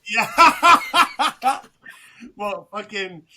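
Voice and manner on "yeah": louder, higher, laughing yeah; laughing yes. Real, not acted. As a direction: laugh it out loud. Bursts out laughing at joke.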